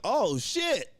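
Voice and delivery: high-pitched retold exclamation; jolted re-enacted reaction; startled. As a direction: blurt it in mock shock. Startled as lights come back.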